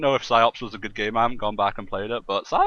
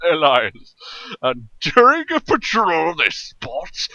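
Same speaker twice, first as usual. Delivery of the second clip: epic trailer narrator; 10 semitones higher, 10 dB louder than usual. Pretend. Reading the game plot to friends as an overblown trailer announcer. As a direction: read the plot like a big action trailer.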